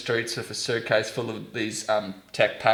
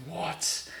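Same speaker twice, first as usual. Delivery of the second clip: soft, high incredulous question; retold astonishment; 7 semitones higher, 9 dB quieter than usual. Visitor hearing of shared school, hushed astonishment.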